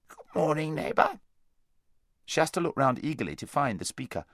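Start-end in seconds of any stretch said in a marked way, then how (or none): 0.1-1.2 high, wheezy hedgehog greeting; a thick, wheezy little creature voice. A narrator's reading. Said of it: "a thick and rather wheezy voice".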